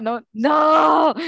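loud, high, long "no"; hammed-up drawn-out cry; mock child's wail. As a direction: howl it like a protesting kid. Acting her child self crying out.

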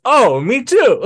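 put-on high, loud, cheerful Italian accent. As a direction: chirp it like an eager Italian friend. Italian friend misunderstanding slang.

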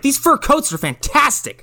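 high-pitched gushing over luxury. Retold or imitated. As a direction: gush like you've just become rich. Gushing over newfound wealth.

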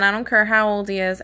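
ordinary delivery

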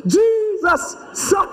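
very high, stretched-out cry; desperate shouting. Acted. Blind man calling to Jesus again, high desperate shout. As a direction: shout the name high, then break off. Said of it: "he was shouting".

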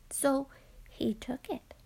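ordinary delivery, nothing marked

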